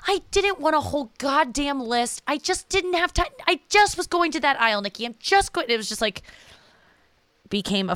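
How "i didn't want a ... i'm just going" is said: much higher, exasperated mom voice; imitating mom. Imitating mom defending her grocery trip, shrill-high. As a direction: mimic mom snapping back, pitched way up. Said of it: "She's like".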